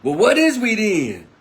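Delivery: loud, high challenge called from the crowd; retold heckling yell. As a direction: shout it back over the crowd. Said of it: "young man yelled out from behind some people".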